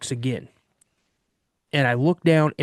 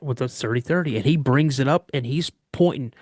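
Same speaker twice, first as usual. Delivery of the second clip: plain reading voice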